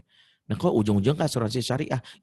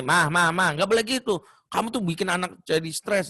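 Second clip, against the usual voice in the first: mock scolding husband; 6 semitones higher than usual. Husband scolding wife, wrong way.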